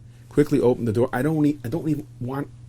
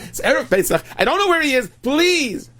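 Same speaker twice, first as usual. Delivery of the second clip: high, loud, distraught wife; frantic begging, pitched way up; panicked pleading; 14 semitones higher, 5 dB louder than usual. Acted. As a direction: plead at the end of your rope.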